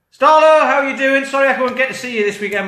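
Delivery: quoting a cheerful pal calling over; cheery friend's greeting. Retold or imitated. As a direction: call out like a chirpy mate. Voicing a friend's cheery call.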